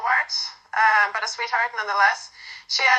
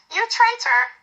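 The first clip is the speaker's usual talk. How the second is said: much higher, playfully outraged cry; mock offended; 11 semitones higher than usual. A narrator's reading. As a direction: ham up being betrayed. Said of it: "her expression mock offended".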